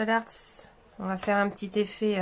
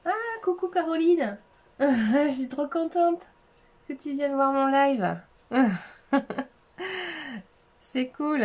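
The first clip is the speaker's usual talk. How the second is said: excited greeting; 6 semitones higher, 5 dB louder than usual. Real, not acted. Greeting first viewer of live.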